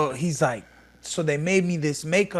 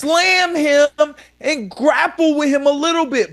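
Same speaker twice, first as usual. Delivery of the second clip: much higher and louder, exasperated; heated outburst; 10 semitones higher, 5 dB louder than usual. Real, not acted. Fighter ranting at a wrestler who avoided grappling, loud and high. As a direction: let the frustration burst out.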